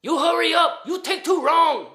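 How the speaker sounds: loud, high mock-accent yell; bellboy yelling at the man across the hall to hurry; shouting bellboy. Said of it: "got told from the bellboy".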